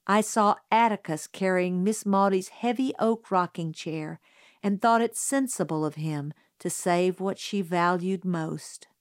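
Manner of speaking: plain reading voice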